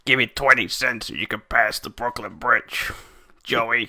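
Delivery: hammed-up Brooklyn accent; comic New York tough-guy accent; New York bridge troll. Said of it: "living under a bridge in New York City".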